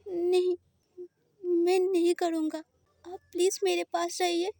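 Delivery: plead, close to tears; lightly voiced scared boy. Boy begs not to be sent back, a hint of tears.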